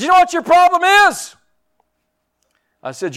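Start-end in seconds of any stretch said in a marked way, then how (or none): 0.0-1.3 sudden high, loud yell at him. Retold or imitated. Pastor yelling at a man stuck on his past. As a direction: explode at the man in the prayer line. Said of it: "and I yelled at him".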